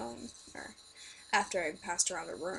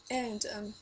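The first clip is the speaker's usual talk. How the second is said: ordinary talk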